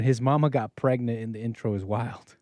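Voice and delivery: normal talking voice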